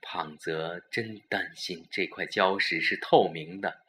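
usual speaking voice

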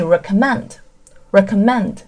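"recommend" with the stress on final "mend". Shown as a demonstration. Teacher gives learners the verb form, "recommend" stressed on "mend". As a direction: land on "mend" at the end of "recommend". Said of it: "你会发现它的重音是在recommend".

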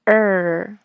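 er with the tongue tip curled up, not touching; retroflex R. Shown as a demonstration. Teacher demos the curled-tongue er sound. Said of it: "不要碰到上颚".

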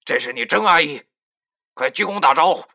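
loud, high, barely held-back temper; suppressed anger. A narrator's reading. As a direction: bark the order through held-in rage. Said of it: "压着心中的怒火". (强压怒火地命令)

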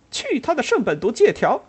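high, loud defiant curse; shouting at the top of voice. Acted. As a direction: yell it with full force. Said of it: "他扯开嗓门叫着".

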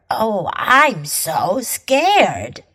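slow mock fear, every word stretched; sarcastic, long-drawn pretend fright; drawn-out sarcasm. Shown as a demonstration. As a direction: drag out each word sarcastically. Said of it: "把这句话拉长每个字来说，表示讽刺".

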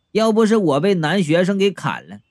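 plain reading voice

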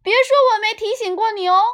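put-on high, fast teasing kid voice; little brother voice. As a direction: rattle it off like a bratty boy. Brother teases sister.

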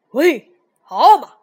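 very loud, aggressive hail; menacing turtle shouting at prey; vicious snapping turtle. A narrator's reading. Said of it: "鳄龟恶狠狠地说".

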